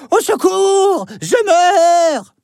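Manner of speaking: high hammed-up wail; fake cry for help. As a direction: pretend to be hurt, calling. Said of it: "se met à gémir, comme s'il était blessé".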